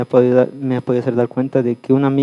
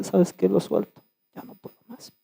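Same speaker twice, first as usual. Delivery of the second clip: defeated near-whisper; 6 semitones higher, 9 dB quieter than usual. Retold or imitated. Retold moment of giving up.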